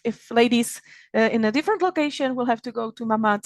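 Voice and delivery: ordinary talk, nothing marked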